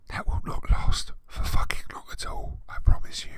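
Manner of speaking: hushed ominous whisper; whispered warning. Real, not acted. Reader warning viewers the story gets worse. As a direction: whisper it like a dark promise.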